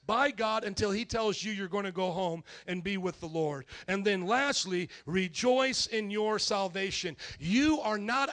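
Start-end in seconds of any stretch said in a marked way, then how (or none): none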